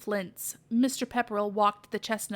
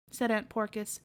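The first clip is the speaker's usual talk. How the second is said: ordinary delivery, nothing marked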